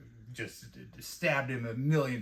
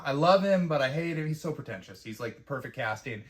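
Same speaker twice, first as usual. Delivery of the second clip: normal talking voice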